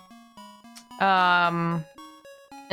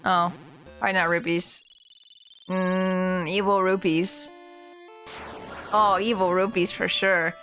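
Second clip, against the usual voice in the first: usual speaking voice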